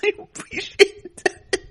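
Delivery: words breathed out through giggles; slow, half-whispered, cracking up; laughing through it. Acted. [name] voiced while laughing.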